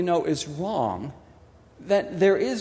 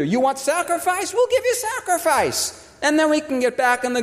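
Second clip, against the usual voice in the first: high, laughing mock voice of the people; mock Israelite offer; 7 semitones higher than usual. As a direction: sneer it out like a cheap bargain. Mocking Israelites' offer to God.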